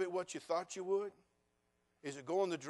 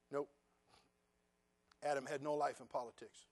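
ordinary talk

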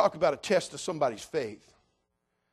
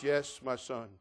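low, soft voice of God; voice from heaven; 3 semitones lower, 5 dB quieter, slower than usual. Acted. God answering man on the cliff, voice from heaven. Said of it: "a voice comes from heaven".

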